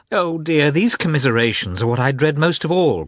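exasperated outcry at her sister; flaring up. Acted. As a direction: burst out, fed up with pity. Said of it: "cried Kitty, suddenly flaring up".

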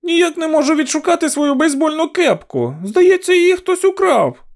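put-on high, loud child voice; very high childish shout; girl shouting to mother. A narrator's reading. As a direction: call out to mum in a little girl's voice. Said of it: "вона крикнула матері".

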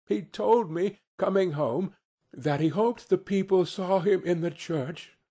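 a touch of tender trembling; tremulous. A narrator's reading. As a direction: tell it with restrained emotion, voice lifted. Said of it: "Bob's voice was tremulous when he told them this".

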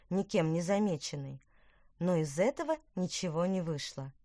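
ordinary delivery, nothing marked

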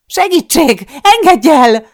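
very high, very loud scream for help; full-throated scream. A narrator's reading. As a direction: scream as hard as your throat allows. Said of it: "Sikoltok, ahogy a torkomon kifér".

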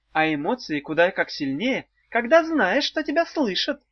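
high, icy witch voice. Acted. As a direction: lecture them coldly, like a witch. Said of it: "ледяным голосом сказала ведьма".

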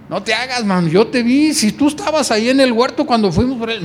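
high and loud, insisting; insistent accuser. Acted. A man insists he saw Peter in the garden. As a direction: nail him with what you saw.

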